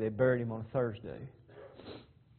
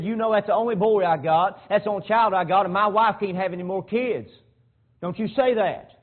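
loud, high, pleading father; raised anguished protest; angry plea; 8 semitones higher, 11 dB louder than usual. Retold or imitated. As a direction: shout it as a hurt father begging.

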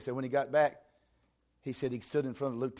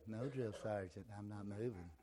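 soft timid recruit answer; meek quiet reply; 4 semitones lower, 11 dB quieter than usual. Shown as a demonstration. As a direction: mumble it meekly. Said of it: "You wouldn't say".

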